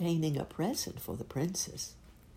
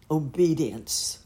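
firm slow prince; 7 dB louder, slower than usual. Acted. Prince answers firmly.

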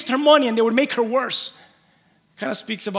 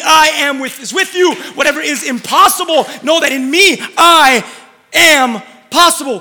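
preaching climax; 3 semitones higher, 6 dB louder than usual. Acted.

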